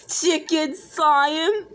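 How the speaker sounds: slow, stretched questioning tune; drawn-out teasing. Acted. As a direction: savour each word of the taunt. Reads the teasing caption aloud.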